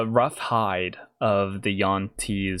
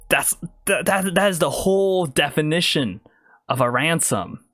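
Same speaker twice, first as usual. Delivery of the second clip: exasperated; 6 semitones higher than usual. Acted. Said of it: "smack her forehead and go".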